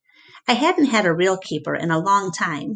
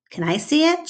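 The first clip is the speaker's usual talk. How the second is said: put-on higher girl's voice asking; a girl's higher voice asking sweetly; Julia's eager voice; 10 semitones higher than usual. A narrator's reading. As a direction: ask like an eager young girl. Julia, a girl, asks.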